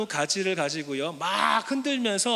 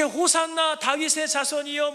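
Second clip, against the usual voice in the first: higher, joyful acclaim; crowd's cheer; 7 semitones higher than usual. Retold or imitated. Preacher voices the crowd's hosanna, excited. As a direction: hail him like the crowd.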